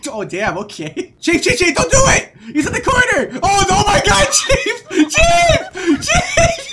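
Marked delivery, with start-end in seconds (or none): 1.2-6.7 high panicked screams of Chief; screaming. Real, not acted. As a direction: scream a warning, laughing. Teammate in danger, screaming.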